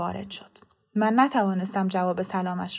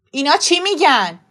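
loud, higher-pitched bewildered demand; affronted, raised voice; agitated demand; 5 semitones higher, 9 dB louder than usual. A narrator's reading. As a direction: demand an answer from your wife. Baffled father turns to wife, demanding.

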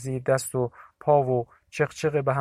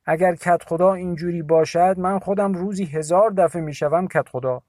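higher, louder mocking retort; Agha Mashi's scoffing voice; 5 semitones higher, 6 dB louder than usual. A narrator's reading. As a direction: sneer at the headman. (صدای تمسخرآمیز مرد)

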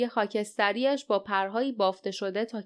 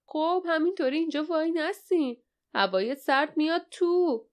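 higher-pitched impatient urging; 7 semitones higher than usual. A narrator's reading. Small man waving hesitant travellers in from the cold, impatient. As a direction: hurry them along in a raised pitch.

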